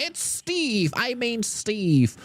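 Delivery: slow, dull, lazy answer; bored chatter voice. Retold or imitated. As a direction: drag it out like a lazy viewer. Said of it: "Don't just be like".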